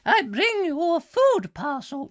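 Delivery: very high, silly falsetto; caricatured female visitor. Retold or imitated. Visitor delivering a food parcel, caricatured voice. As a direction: put on an exaggerated squeaky woman's voice. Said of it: "She doesn't actually speak like that".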